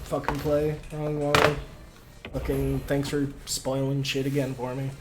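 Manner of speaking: low, soft and dejected; deflated, quietly disappointed; bummed out. Real, not acted. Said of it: "I'm just fucking bummed out now".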